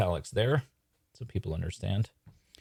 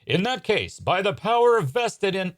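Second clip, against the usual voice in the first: high, declaimed magician voice; resume the pompous incantation, pitched up; grand proclamation; 12 semitones higher, 6 dB louder, slower than usual. Acted. Magician resuming his interrupted incantation, grand proclamation.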